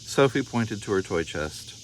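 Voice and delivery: plain reading voice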